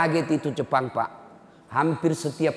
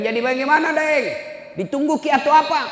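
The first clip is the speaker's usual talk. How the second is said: high, louder retold phone question; insistent questioning; 14 semitones higher, 5 dB louder than usual. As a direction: press him for an answer. (bertanya mendesak dengan nada tinggi)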